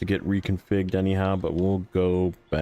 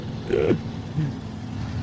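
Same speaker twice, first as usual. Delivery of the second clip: soft airy giggle; breathy laugh; 4 semitones higher, 4 dB quieter than usual. Real, not acted. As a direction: let out a quiet breathy laugh. Laughs at chat.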